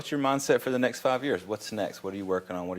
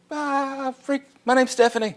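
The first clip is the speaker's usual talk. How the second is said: starstruck panic; 12 semitones higher, slower than usual. Retold or imitated. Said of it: "I'm like".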